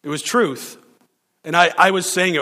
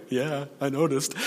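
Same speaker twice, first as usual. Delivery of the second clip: quiet dry understatement; dry aside; 7 dB quieter than usual. Retold or imitated. Pastor answering the woman's confession, quiet dry aside. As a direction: drop it flat and soft as a wry aside. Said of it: "I'm like".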